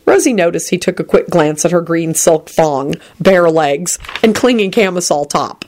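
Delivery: plain reading voice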